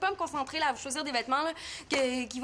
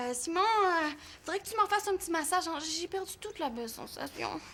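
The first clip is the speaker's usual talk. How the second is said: usual speaking voice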